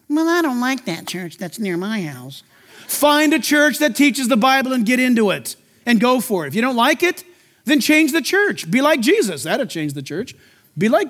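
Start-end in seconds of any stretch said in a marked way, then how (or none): 0.1-2.4 lower, softer, slow grumble; mock a picky churchgoer; whiny complainer. Mocking a complaining listener. 9.4-10.3 much lower and softer; dropped low aside. Real, not acted.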